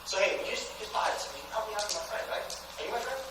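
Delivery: soft, needy mock plea; begging. Recruit begging a friend to buy, pleading. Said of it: "I'm, you know, begging him".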